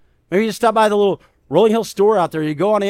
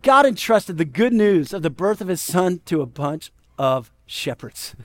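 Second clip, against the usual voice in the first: ordinary delivery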